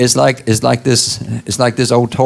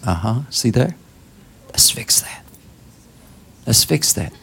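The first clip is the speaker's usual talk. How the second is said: hushed, patient correction; gentle God; 4 dB quieter than usual. Retold or imitated. God gently telling the believer, soft and patient. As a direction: soothe like a kind father fixing a mistake. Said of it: "He says".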